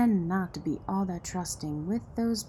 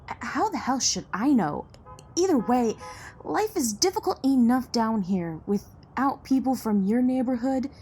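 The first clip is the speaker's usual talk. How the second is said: raised, louder, indignant voice; heated rebuke; 5 semitones higher, 6 dB louder than usual. Acted. Local scolding a rich outsider about the neighborhood, heated. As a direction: let the frustration push the voice up.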